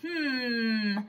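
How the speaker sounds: normal talking voice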